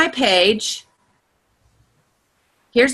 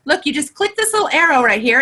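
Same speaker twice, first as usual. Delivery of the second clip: usual speaking voice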